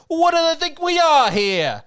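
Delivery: put-on loud, high, slow Long Island accent; thick, indignant Long Island accent. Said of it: "in the most Long Island accent ever".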